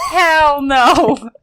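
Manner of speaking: emphatic refusal. Real, not acted. Emphatic reaction.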